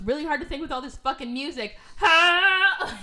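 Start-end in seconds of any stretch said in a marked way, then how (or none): 2.0-3.0 mock laugh. Character laughs.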